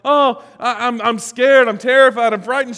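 loud, high-pitched mock panic; imagined fright. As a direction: stammer out an acted fright. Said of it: "When you think Mary's gonna say something like".

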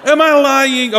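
high, loud laughing question. Real, not acted. Preacher laughing as he asks the crowd.

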